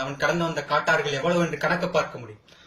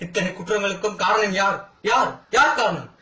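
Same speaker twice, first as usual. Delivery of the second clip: raised voice, building to a loud, high repeated question; accusing demand; 4 semitones higher, 4 dB louder than usual. Acted. Brother demands the court say who caused these crimes, accusing. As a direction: hammer the question at the listeners.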